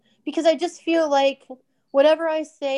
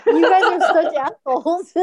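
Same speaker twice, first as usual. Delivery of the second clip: laughing at friends; laughing insult while teased; 6 semitones higher, 5 dB louder than usual. Real, not acted.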